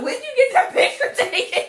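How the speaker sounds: high, loud, laughing over each word. Real, not acted. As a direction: squeal it out mid-laugh. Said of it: "I'm fucking dying laughing".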